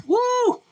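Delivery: sudden high whoop of delight; high excited woo. Real, not acted. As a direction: whoop with joy. Excited about noodles.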